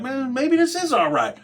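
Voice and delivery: high, slow, dawning approval; slow high musing on a comfy jail; pleasantly surprised. Retold or imitated. Won over by the unit.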